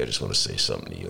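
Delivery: low, fast mock Bloomberg mumble; Bloomberg impression. Host mimicking Bloomberg inviting himself on show, low fast mumble. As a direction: rattle it off deep as a stiff billionaire. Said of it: "That's my Bloomberg invitation".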